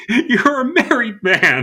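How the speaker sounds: high, slow, laughing disbelief. Real, not acted. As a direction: laugh through the words. Laughing at cohost's horny bit.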